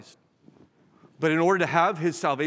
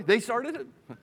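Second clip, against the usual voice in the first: raised-pitch mock kid's complaint; childish blame; 6 semitones higher, much slower than usual. Preacher mimicking kids shifting the fault, childish blame. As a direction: pitch it up like a kid passing blame. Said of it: "You think just kids say that".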